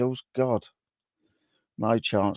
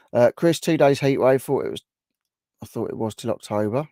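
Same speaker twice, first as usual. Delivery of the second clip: ordinary delivery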